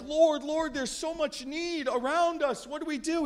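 high, pleading plea to the Lord; pleading disciples. Retold or imitated. Disciples asking Jesus what to do, in a high pleading voice. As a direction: beg like a worried disciple.

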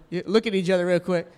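ordinary delivery

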